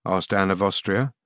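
ordinary delivery

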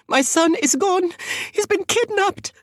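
very high, hysterical woman's voice; panicked scream. A narrator's reading. Mother reporting her kidnapped son, hysterical. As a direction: cry out in high-pitched panic. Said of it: "the woman screamed".

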